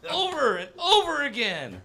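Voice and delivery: high, slow, strained wailing; imagined grandpa crying out in the night, high and wailing; mock anguished cry. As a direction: ham up a dying man crying out. Imagined grandpa dying in pain.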